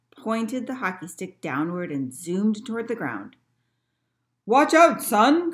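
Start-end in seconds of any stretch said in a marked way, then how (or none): 4.4-5.5 loud, high shout of warning; captain calling out in alarm; cried warning. A narrator's reading. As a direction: shout a storybook warning to the lad. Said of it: "cried the captain".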